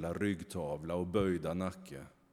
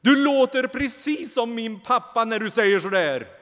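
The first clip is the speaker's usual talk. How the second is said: much higher, louder raging voice; furious retort; 13 dB louder than usual. A narrator's reading. Kain rages back at the angel. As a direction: turn on him in a rage. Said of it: "Kain vänder sig rasande emot honom".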